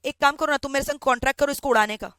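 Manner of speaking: ordinary talk, nothing marked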